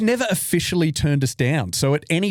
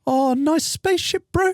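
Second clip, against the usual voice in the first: put-on Kiwi accent; 14 semitones higher than usual. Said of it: "doing the Kiwi accent".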